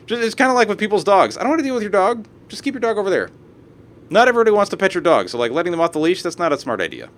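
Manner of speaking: usual speaking voice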